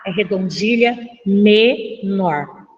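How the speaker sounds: "menor" drawn out as me... nor; low, deliberate teacher's correction; emphatic repetition. Shown as a demonstration. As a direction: split "menor" into two held beats. Teacher repeats the corrected term.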